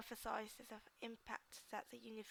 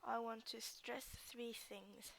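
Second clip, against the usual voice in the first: slow; slower than usual. Shown as a demonstration. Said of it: "modelling an example sentence".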